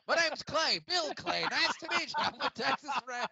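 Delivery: put-on loud, very high-pitched Texan accent; Southern accent. Co-host joins the joke as Bill Clay, exaggerated Texan accent. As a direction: ham up a Texas cowboy, high and loud. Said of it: "let's hear the Southern accent".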